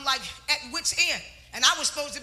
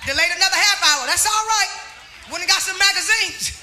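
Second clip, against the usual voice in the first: raised, loud preaching voice; preaching testimony; 3 semitones higher, 3 dB louder than usual. Acted. Preacher telling the congregation her story, loud preaching. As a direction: testify to the church with force.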